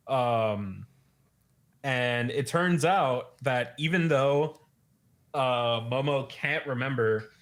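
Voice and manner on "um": ordinary talk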